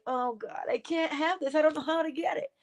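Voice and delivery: high, hushed, hopeless whimper; half-hearted imitation of a fretful wish; mock despair. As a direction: play the one who thinks it's out of reach. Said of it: "or else you're like".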